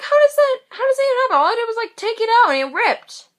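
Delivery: high, loud incredulous wail; exasperated disbelief. Retold or imitated. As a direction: replay the bafflement in a high, loud voice. Acted disbelief over ripped contact.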